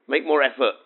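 ordinary talk, nothing marked

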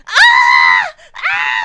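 loud, high banshee shriek; banshee wail. Retold or imitated. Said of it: "I sound like a banshee".

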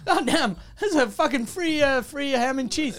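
high, dopey delight over free food; mock delighted passenger. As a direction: play a happy simple passenger.

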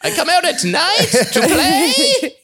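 high, slow, spooky ghoul voice; horror voice. Pretend. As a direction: play a creepy covid monster, laughing. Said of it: "You guys like my horror voice".

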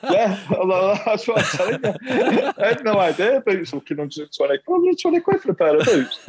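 laughing. Real, not acted. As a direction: laugh it out in disbelief at the price. Laughing about pricey boots.

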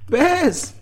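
high, loud summons; calling out. A narrator's reading. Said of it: "called the Ard Slanaha, laughing".

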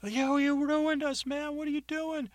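high, slow accusing voice; angry fired worker. Acted. Fired worker confronts James.

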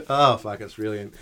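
normal talking voice

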